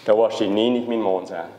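normal talking voice

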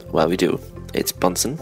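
ordinary delivery

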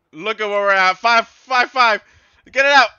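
loud, tense yelling at the players; urgent shouting. Real, not acted. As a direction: shout them through the last minutes. Urges defense in final minutes.

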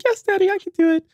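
put-on high, bright child's voice; daughter's voice. Daughter's voice in retold story.

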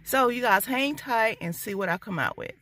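ordinary talk, nothing marked

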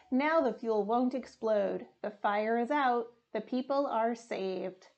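usual speaking voice

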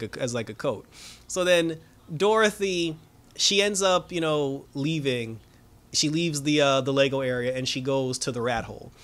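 ordinary delivery

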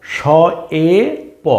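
শ এ প split with pauses; slow letter-by-letter শ, এ, প; spelled out slowly; syllable by syllable. Shown as a demonstration. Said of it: "এটাকে পড়তে হয় শয়ে প".

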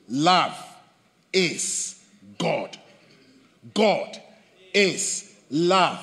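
each word set apart with pauses, "Love" and "God" stressed; slow, deliberate, word by word; weight on every word; emphatic words. Shown as a demonstration. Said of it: "where my emphasis is".

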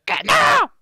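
very high, loud put-on scream; piercing falsetto yell; horrified shriek. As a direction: squeal the refusal in cartoon panic. Girl sees the creeper.

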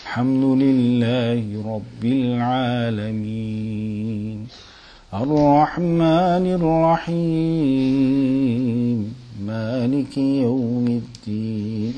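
low, soft, mournful chant; sad melodic recitation. Shown as a demonstration. Someone sad relating to the Fatiha, mournful recitation. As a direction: chant it sorrowfully, quietly drawing out the endings. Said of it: "Imagine someone sad and they wanna relate to".

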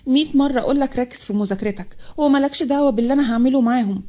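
ordinary delivery, nothing marked

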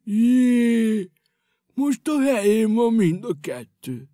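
surprised his feet are fixed; amazed. Acted. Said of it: "elcsodálkozott".

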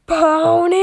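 high, loud desperate call. Acted. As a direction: shout the name across the room. Calling out for lost friend.

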